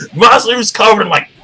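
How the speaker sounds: normal talking voice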